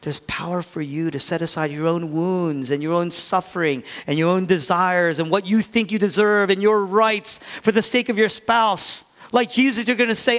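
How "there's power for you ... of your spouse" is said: normal talking voice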